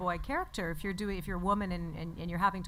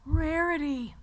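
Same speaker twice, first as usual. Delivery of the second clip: higher, lovestruck cartoon boy; Spike; 7 semitones higher, 4 dB louder, slower than usual. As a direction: sigh the name adoringly as a boy. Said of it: "for Spike".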